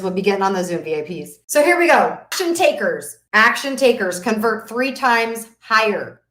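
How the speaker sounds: normal talking voice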